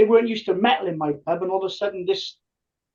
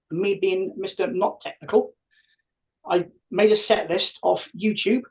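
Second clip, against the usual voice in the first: normal talking voice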